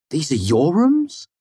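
high-pitched, put-on disbelief; feigned dismay. A narrator's reading. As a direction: act dismayed to find out. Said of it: "proper amount of dismay in my tone".

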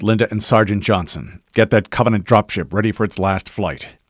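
loud, commanding military orders; rapid-fire orders. A narrator's reading. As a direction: give each team its job at firm volume. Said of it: "The Master Chief gave rapid-fire orders".

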